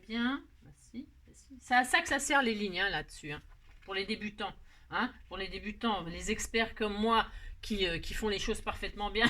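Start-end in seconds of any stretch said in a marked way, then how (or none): 0.6-1.6 a breathless whispered "Bah si"; whispered aside. Real, not acted. Aparté pour elle-même. 6.0-9.3 mock boasting. Se vante ironiquement puis rit.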